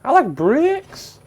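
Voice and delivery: high, slow, drawn-out cartoon pig voice; squealing "Woo!" then a slow, high pig line; first little pig. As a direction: stretch it out like a giddy little pig. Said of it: "the third pig was like".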